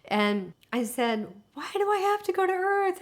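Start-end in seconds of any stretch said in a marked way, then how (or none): 1.5-3.0 plaintive protest. Retold or imitated. Woman asking light beings why she must leave, whining. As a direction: plead it like a reluctant child, pitched way up.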